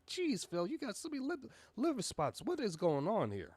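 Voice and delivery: high, mock-shocked jab at a friend. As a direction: pretend to be shocked, teasing. Mock address to Phil.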